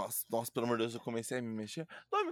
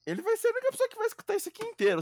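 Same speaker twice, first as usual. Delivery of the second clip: high fast voice; 4 dB louder, much faster than usual. Acted. Joking about only listener.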